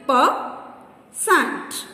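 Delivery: "Peasant" in two beats, Pea, sant; long pause in the middle of "Peasant"; syllable by syllable. Shown as a demonstration. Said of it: "Two syllables".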